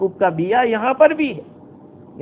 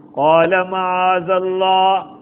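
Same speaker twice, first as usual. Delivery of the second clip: each word stretched out, chanted; drawn-out tilawat of the verse; slow Quranic recitation; 4 dB louder, much slower than usual. Acted. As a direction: intone the verse very slowly.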